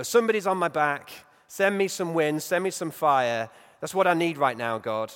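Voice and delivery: loud, urgent plea for wind and fire; demanding Elijah. Retold or imitated. Preacher voicing Elijah's loud plea to God. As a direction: call out for fire like a desperate prophet.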